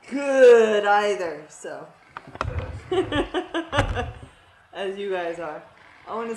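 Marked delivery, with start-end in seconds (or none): 2.1-4.2 high rattling giggle; laughing. Real, not acted. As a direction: laugh off your own modesty. Self-deprecating laugh.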